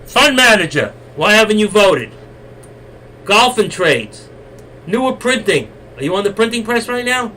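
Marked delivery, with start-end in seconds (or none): 0.0-5.7 louder, higher call-out; single out a lurker. Real, not acted. Calling out viewer by name.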